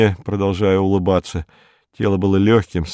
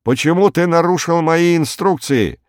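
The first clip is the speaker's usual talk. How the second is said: high-pitched angry demand; barked reproach; 9 semitones higher than usual. A narrator's reading. Lemming scolding Berkut over broken instructions, barking. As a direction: demand an answer, indignant. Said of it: "рявкнул в ответ".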